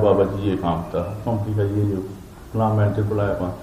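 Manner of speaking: soft, low, subdued excuse; meek explanation. Retold or imitated. Explaining lateness to Baba ji, meek and low.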